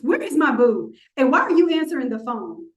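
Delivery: usual speaking voice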